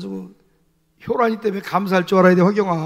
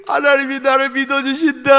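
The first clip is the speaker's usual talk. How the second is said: higher and louder, overwhelmed with relief; moved exclamation; 5 semitones higher, 5 dB louder than usual. Retold or imitated. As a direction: cry it out as a sudden revelation. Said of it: "펑펑 울어서".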